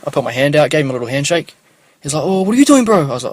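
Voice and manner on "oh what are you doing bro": high, loud and pushy; loud street stranger. Retold or imitated. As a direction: fire the question up high and loud. Stranger quizzes him.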